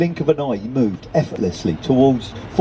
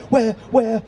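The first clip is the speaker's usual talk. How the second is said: high, panicky double question; startled alarm; 10 semitones higher than usual. A narrator's reading. Old lady looking for the bird, startled alarm. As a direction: ask in a sudden high fluster.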